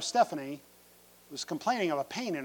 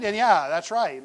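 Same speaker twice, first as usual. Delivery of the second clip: ordinary talk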